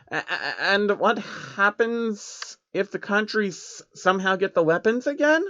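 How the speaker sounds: a jittery changeling barely getting the words out; frightened creature stuttering at the villains; nervous stammer. Acted.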